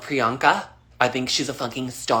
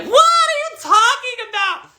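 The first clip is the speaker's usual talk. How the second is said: much higher, disbelieving mock mom voice; imitating mom; 4 dB louder than usual. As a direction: squeak it out like a doubting mother.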